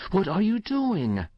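high-pitched alarmed cry. A narrator's reading. Mother confronts her son on the rocking horse, crying out. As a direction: cry out in disbelief. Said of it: "she cried".

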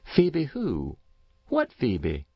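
puzzled neighbour, high start falling away; lightly voiced curious old woman; curious country woman. A narrator's reading. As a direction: ask it lightly baffled, starting high and dropping. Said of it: "replied Missus Race, curious".

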